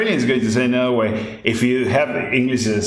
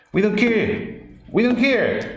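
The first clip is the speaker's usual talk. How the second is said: higher, firm insistence on "We don't care"; emphatic repetition; 8 semitones higher than usual. Real, not acted. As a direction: hammer home that we don't care. Insisting he does not care.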